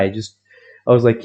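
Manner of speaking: ordinary talk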